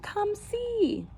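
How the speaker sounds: high and eager; excited call. Acted. Husband calling his wife to the door, excited. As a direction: beckon her over, thrilled.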